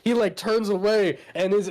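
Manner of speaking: ordinary talk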